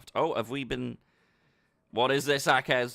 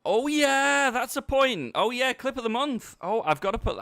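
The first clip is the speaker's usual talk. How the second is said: excited; 13 semitones higher, 7 dB louder than usual. Real, not acted. Sudden idea, clip of the month.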